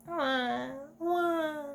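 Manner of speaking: high wah wah wail; hammed-up sobbing; mock crying.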